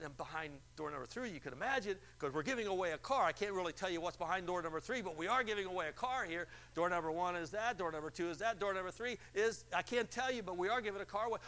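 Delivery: announcer teasing the car prize to the caller; radio announcer. Retold or imitated. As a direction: hype the hidden car.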